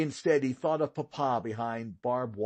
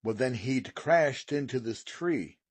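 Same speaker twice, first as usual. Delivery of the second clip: ordinary delivery, nothing marked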